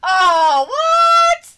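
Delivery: high, loud stunned shout; excited shout. Real, not acted. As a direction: yell it in disbelief at the match. Stunned by a wrestling spot.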